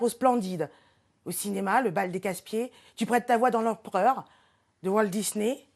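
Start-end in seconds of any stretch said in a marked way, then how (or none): none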